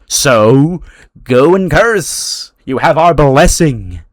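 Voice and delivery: loud, high, drawn-out proclamation; slow, ringing mock-heroic command; grand send-off. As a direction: bestow a blessing with mock grandeur. Sending him off to curse people.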